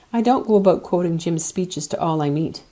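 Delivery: usual speaking voice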